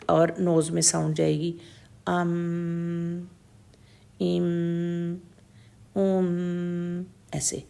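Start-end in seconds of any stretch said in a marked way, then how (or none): 2.1-7.0 ام with closed lips humming through the nose; sakin meem demo. Shown as a demonstration. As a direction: hold ام, sound going into the nose. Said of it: "سکون سے دونوں ہونٹ بند ہو جائیں گے". (ام کو ہونٹ بند کر کے ناک میں گنگنائیں)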